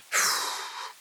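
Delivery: audible sighing out-breath; demonstrated exhale. Shown as a demonstration. Guide leading the second counted breath, demonstrated exhale. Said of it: "And breathe out".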